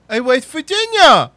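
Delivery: high, loud, slow slurred imitation; frustrated janitor voice. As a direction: wail it out in the janitor's odd voice. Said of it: "you be the janitor".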